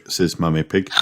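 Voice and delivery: plain reading voice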